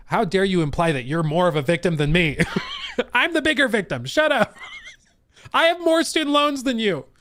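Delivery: high, loud, laughing fake outrage; mock indignant. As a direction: play the bigger victim, squealing. Mock victim argument with chat.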